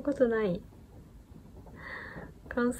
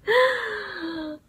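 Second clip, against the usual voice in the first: burst of laughter; 12 semitones higher, 4 dB louder than usual. Real, not acted. Laughing after her fake Kansai.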